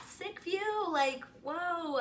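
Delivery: high, breezy fake nonchalance; faking cool. Walking past the celebrity to the porch, faking cool. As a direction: act unbothered while panicking. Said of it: "trying to play it so cool".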